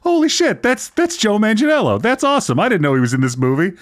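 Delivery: high-pitched, retold burst of fan excitement; starts high and excited, then quick; excited reaction. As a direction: relive the thrill of spotting a favorite actor. Acts out own excited reaction.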